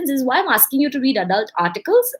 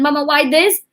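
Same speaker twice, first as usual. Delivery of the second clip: put-on higher, eager kid asking why; a child pestering with a question; curious child; 6 semitones higher, 4 dB louder than usual. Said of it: "next time they come to you curiously".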